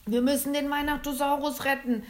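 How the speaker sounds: high, loud, pressing urgency; urgent. A narrator's reading. Father Christmas urging the others to save the dinosaur, urgent and loud. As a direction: push them to act now. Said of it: "drängte der Weihnachtsmann".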